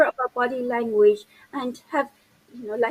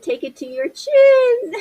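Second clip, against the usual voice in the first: high, loud, drawn-out gleeful reminder; laughing reveal; 10 semitones higher, 6 dB louder, slower than usual. Real, not acted. Presenter reveals the trick to the host, laughing triumphantly. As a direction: stretch out "chin" and break into laughter.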